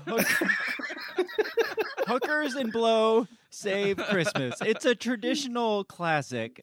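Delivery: laughing while reading title. Real, not acted. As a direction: crack up on the title.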